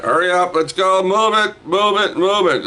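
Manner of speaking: very high, urgent repeated calls; high-pitched hurry-up; hustling people along. Real, not acted. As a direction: chivvy them along in a high voice.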